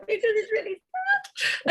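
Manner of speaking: high, laughing half-sob retelling; laughing while quoting. Retold or imitated. Mother retells her tears at the film, laughing. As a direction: laugh through your own words from the film.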